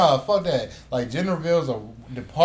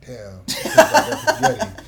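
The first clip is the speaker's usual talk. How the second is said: laughing; 9 semitones higher, 5 dB louder than usual. Retold or imitated. Imagined reaction to raisin spaghetti.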